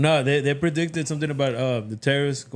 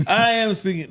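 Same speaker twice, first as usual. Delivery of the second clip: louder, higher retort; speaking up; 8 semitones higher, 5 dB louder than usual. Real, not acted. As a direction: push the voice up in protest. Told to speak up, answers louder.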